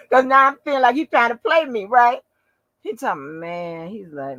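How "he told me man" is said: usual speaking voice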